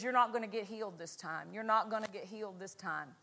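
low, soft, quick devil voice; devil's taunt. Retold or imitated. Devil taunts a sick preacher in his thoughts, low soft taunt. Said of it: "The devil says".

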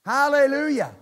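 high, loud shout of praise; loud hallelujah. Real, not acted. Preacher shouting praise to the congregation. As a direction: call it out to the church.

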